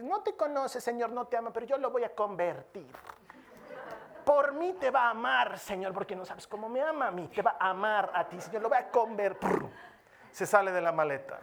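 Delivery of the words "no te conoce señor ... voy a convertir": lightly voiced, pleading naive believer. Believer telling God she will convert her boyfriend, mock pleading.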